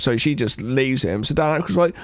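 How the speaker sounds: usual speaking voice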